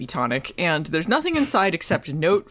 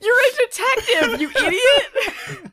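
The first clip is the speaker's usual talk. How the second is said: laughing. Retold or imitated. Exasperated mock insult, laughing.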